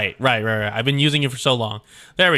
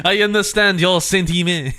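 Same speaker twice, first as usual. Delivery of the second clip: "I understand your sentiment" in a higher, louder, exaggerated French accent; hammed-up French accent; 6 semitones higher, 4 dB louder than usual. Latour answering Catherine in a hammed-up French accent. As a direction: push the French accent over the top, breaking up. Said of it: "I don't know why, the French is".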